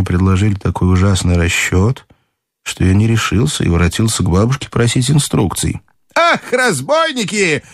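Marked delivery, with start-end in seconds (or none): 6.1-7.6 high, drawn-out indignant granny cry; shouting grandmother. A narrator's reading. Grandmother hearing the terrible exchange rate, indignant cry. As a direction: cry out slowly in high outrage. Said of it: "закричала она".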